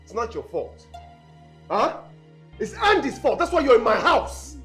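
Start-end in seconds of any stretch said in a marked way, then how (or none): 2.6-4.6 raised, heated blaming; angry outburst. Acted. Landlord blaming another for tenant being there, angry outburst. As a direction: lash out with voice pitched up.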